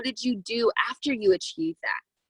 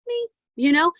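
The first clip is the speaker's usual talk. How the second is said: higher, louder punchline reveal; playful reveal; 7 semitones higher, 4 dB louder than usual. Real, not acted. Revealing to her team that the girl is her, playful. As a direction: land the twist with a grin.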